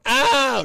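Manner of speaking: sudden high, loud mock "Ow"; mocking yelp. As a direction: yelp it like a mocked complainer. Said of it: "somebody competent going".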